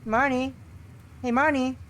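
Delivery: cheerful call to a sleeper. Acted. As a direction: call across to wake her. Character calling out.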